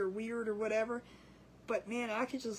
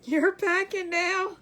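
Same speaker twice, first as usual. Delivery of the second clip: laughing; 5 semitones higher, 7 dB louder, much slower than usual. Real, not acted. Viewer asks, she laughs.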